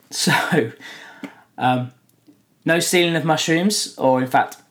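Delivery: ordinary delivery, nothing marked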